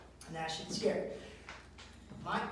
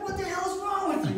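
higher, louder outraged question; indignant retort; 10 semitones higher, 5 dB louder than usual. Retold or imitated. Retelling an argument over dishes, indignant. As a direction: throw it back, mock-outraged.

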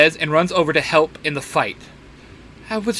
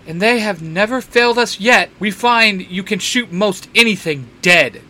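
snarky; 5 semitones higher, 4 dB louder than usual. Acted. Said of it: "in a very snarky tone".

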